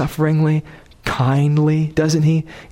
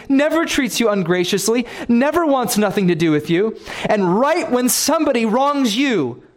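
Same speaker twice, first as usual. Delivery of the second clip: much higher, driving impassioned sermon; preaching at full force; 9 semitones higher than usual. Acted. Preacher urging the church on God's patience, impassioned high preaching. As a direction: plead with the congregation at full fervor.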